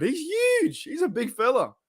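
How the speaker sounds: high wide-eyed wonder at a giant; excited high awe; awed. Real, not acted. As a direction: pitch jumps way up in amazement. Awed by wrestler on screen.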